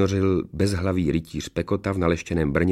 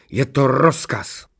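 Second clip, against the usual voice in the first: high, slow, emphatic order; angry command; 6 semitones higher, 4 dB louder, much slower than usual. A narrator's reading. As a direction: shut down the protest with authority. Rumburak rázně odsekne čertovi. (rozzlobený, důrazný rozkaz)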